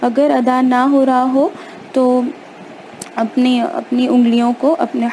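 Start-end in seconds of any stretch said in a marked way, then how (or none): none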